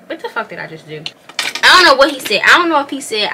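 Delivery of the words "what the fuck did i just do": dropped low and quiet, half to herself; muttered aside. Real, not acted. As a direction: mumble it into the mirror. Aside while doing makeup.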